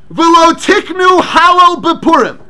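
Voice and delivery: slow, emphatic, half-chanted recitation; declaiming the Rambam. Acted. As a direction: proclaim the text word by word.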